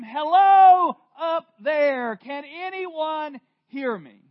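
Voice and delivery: high, slow shout up the cliff; scream for help. Acted. Man hanging from a cliff calls up for help, screaming. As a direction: call out desperately for help. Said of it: "He began to scream".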